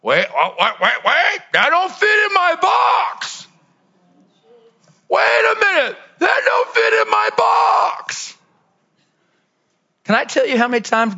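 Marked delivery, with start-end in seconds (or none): 0.0-8.3 startled outcry. Retold or imitated. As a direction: cry out in alarmed objection.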